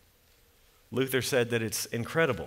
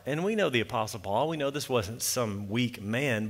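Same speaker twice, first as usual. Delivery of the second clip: normal talking voice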